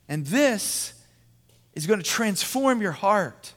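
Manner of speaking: high, loud sermon proclamation; impassioned preaching. Real, not acted. Pastor proclaiming the message to the men, impassioned preaching.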